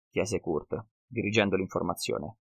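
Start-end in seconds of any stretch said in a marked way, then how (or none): none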